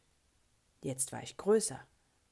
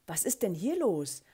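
louder, higher indignant question; loud exclamation; 5 semitones higher, 6 dB louder than usual. A narrator's reading. Teacher confronts silent pupils in schoolyard, loud exclamation. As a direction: burst out with it, pitch leaping.

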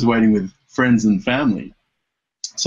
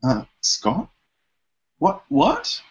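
mock dawning surprise, the second part jumping much higher; imitating surprised girlfriend; 9 semitones higher, 3 dB quieter, much slower than usual. Girlfriend realising the twin swap at the airport, mock startled. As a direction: retell her catching on with a startled, rising what.

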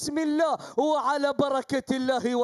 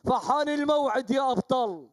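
usual speaking voice

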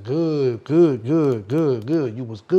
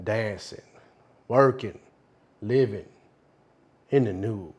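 hushed storyteller; 7 semitones lower, 8 dB quieter than usual. Acted. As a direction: spin the tale low and soft, pausing between phrases. Storytelling in a filmed monologue.

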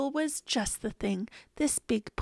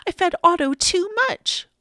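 louder, higher worried confession; urgent confession; 6 semitones higher, 6 dB louder than usual. A narrator's reading. Boy confesses to Mister Carp, urgent. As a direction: blurt out the mistake.

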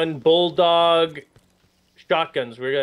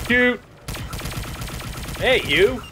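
calling out; 8 semitones higher, slower than usual. Real, not acted. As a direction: shout to get his attention. Calling out to partner.

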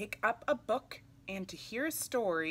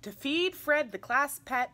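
higher and louder, bright and eager; eager reading; 6 semitones higher, 7 dB louder than usual. Acted. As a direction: sell the fun of feeding the pet. Reading with emphasis.

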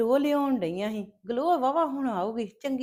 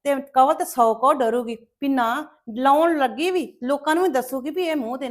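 mocking gloat; 3 semitones higher, 7 dB louder than usual. Jealous relative mocks Kawal, louder and higher with spite. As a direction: drag out the taunt with spite.

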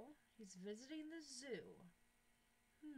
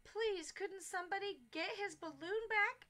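a higher, louder pleading mother voice; 8 semitones higher, 8 dB louder than usual. Acted. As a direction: beg the crowd for help. Mother begs for help.

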